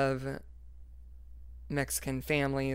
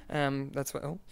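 ordinary talk, nothing marked

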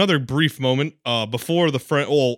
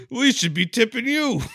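mock gushing praise; 8 semitones higher than usual. Voicing gushing adult praising kid.

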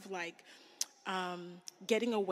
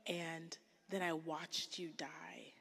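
hushed whisper; 6 dB quieter, slower than usual. Acted. Voicing Mary at the empty tomb.

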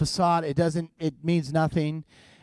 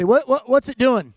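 loud, higher, stuttered question; blunt mechanic; 8 semitones higher, 7 dB louder than usual. Retold or imitated. Mechanic to clueless car owner, loud blunt questioning. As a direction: imitate a mechanic demanding an answer.

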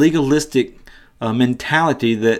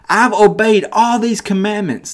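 high-pitched, eager boast; excited interruption; 8 semitones higher than usual. Retold or imitated. Young man interrupts Jesus to boast, excited. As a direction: jump in excitedly with a raised voice. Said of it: "you can just hear the excitement on the guy's voice".

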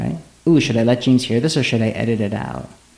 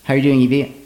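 ordinary talk, nothing marked

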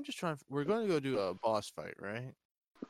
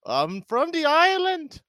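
hammed-up Irish accent; 13 semitones higher, 9 dB louder than usual. Pal clowning, declaring his Irishness in a mock brogue. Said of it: "trying to come out with this Irish accent".

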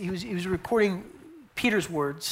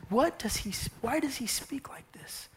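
softer whispered suspicion; inner whisper; 5 semitones higher, 9 dB quieter than usual. Retold or imitated. Scribes doubting Yeshua inside, softer whispered. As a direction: mutter the thought under the breath.

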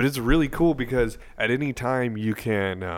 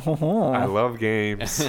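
creepy slow voice; much slower than usual. Pretend.

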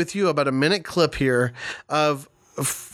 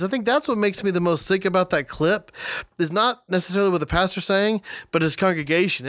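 ordinary talk

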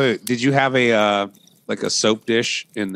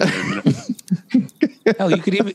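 laughing; 10 semitones higher, 4 dB louder than usual. Real, not acted. Laughing while talking about soap.

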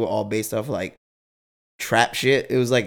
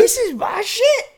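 high, slow, gleeful squeal; squealing brag; 4 dB louder, slower than usual. Retold or imitated. Bragging to friends about the suite, high squealing brag. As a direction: gloat like a kid at a party.

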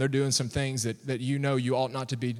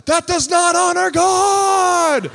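high, drawn-out shout, very loud; yelling; 14 semitones higher, 10 dB louder, much slower than usual. Retold or imitated. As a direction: yell it out slowly across a playground. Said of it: "he's yelling at them".

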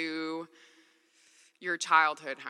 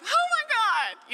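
much higher, louder mock alarm; mock panic; 4 dB louder than usual. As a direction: yelp it in exaggerated dismay. Own panicked reaction quoted.

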